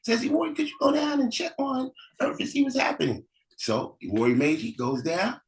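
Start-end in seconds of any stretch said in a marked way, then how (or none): 0.2-3.2 much higher puzzled calling; puzzled elder brother. Acted. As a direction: look round an empty heaven and call. Elder brother sends sibling.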